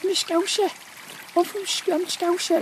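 high-pitched Scouse impression; put-on Scouse accent. Shown as a demonstration. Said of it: "We've got the Scouser".

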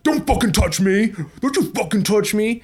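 high, loud furious yelling; angry shout. Retold or imitated. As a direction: scream it at a stranger. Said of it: "and he was like".

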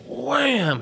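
high, drawn-out "wham" sound effect; imitated impact. Storyteller imitating tent hitting the Bronco, high "wham". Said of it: "he hit it against the".

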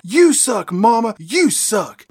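a half-scream, punching each "suck"; mock scream. Daughter worked up at Mama, mock yell. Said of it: "gets all worked up and she screams out".

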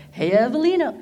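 cheery Yogi Bear-ish hello. Retold or imitated. Boss greets her back, mild Yogi Bear voice. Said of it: "I make it sound like Yogi Bear".

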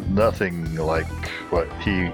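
usual speaking voice

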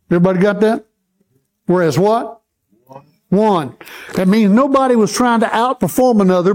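ordinary talk, nothing marked

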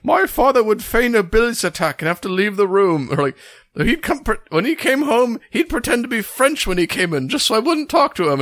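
imitating a high-pitched Yorkshire accent. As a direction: quote Eric in a squeaky Yorkshire voice. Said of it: "he's doing this high-pitched, like, Yorkshire accent".

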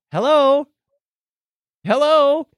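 ordinary talk, nothing marked

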